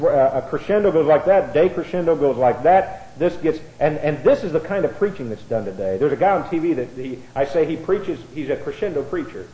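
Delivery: normal talking voice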